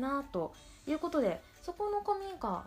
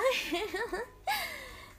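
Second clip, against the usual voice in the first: high giggle; 11 semitones higher than usual. Real, not acted. Laughing at own word choice.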